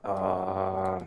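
loud cry of disgust; disgusted shout. Real, not acted. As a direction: yelp in disgust. Stepped in poop.

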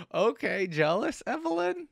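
high, loud teasing question; amused disbelief. Real, not acted. Reactor calling out a jealous Evelyn, high-pitched teasing disbelief. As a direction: pitch it way up, still reeling from the shock. Teasing the character after shocked laughter.

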